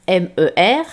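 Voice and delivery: M E R spelled letter by letter to mean the sea. Shown as a demonstration. Teacher spelling the word for pupils, letters one by one. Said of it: "la mère".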